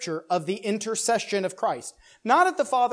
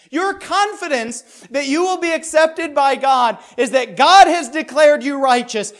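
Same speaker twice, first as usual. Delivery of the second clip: preaching at full force; 8 semitones higher, 12 dB louder than usual. Acted. Pastor exhorting congregation, loud impassioned preaching. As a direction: proclaim it, nearly crying out.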